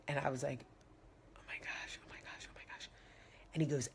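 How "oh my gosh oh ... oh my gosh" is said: breathless whispered panic, much softer; frightened whisper. Retold or imitated. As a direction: whisper it in terror under your breath. Scared in the cab.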